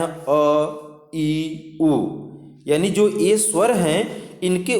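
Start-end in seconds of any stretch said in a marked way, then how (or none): 0.0-1.9 अ ई उ lightly sung and slow; short vowel demo. Shown as a demonstration. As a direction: sing the short vowels slowly. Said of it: "बहुत कम समय लगता है".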